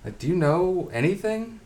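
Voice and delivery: high, mocking disbelief; condescending. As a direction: talk down to her like she's clueless. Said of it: "And it's like".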